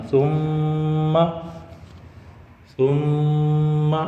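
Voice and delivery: ثم with the م hummed long through the nose; held nasal ghunna. Shown as a demonstration. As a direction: hold the میم of ثم nasally. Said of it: "ایک الف رکنا". (ثم میں غنہ)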